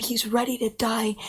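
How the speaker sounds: usual speaking voice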